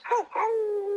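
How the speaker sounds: high wolf howl; howling like a wolf pup. Pretend. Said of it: "You give a little howl there?".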